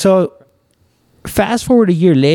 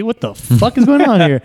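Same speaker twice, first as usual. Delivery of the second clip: higher, puzzled exclamation; retold confusion; 7 semitones higher than usual. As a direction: act baffled by what you're seeing.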